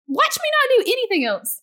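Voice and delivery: high, sassy defiant retort; wife daring her husband, high and sassy; defiant sass. Retold or imitated. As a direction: toss it back, daring him. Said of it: "I would be like".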